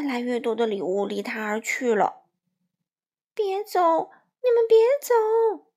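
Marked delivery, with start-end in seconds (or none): 3.3-5.7 much higher, louder frantic pleading; panicked cry. A narrator's reading. Crow watching his gifts fly away, panicked cry. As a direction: beg them frantically to stay. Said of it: "他慌张地叫了起来".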